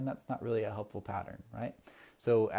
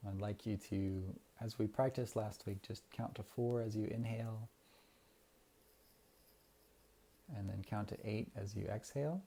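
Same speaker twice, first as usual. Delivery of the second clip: guided meditation. Acted. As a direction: ease the listeners into calm breathing. Leads a breathing meditation.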